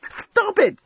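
very high-pitched exasperated yelp; crying out. Acted. Lucy fending off the alien, high exasperated cry. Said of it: "cried Lucy".

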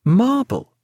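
high-pitched correcting reply; high character voice with a falling end; Sarah's correction. A narrator's reading. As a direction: pitch it high, then drop.

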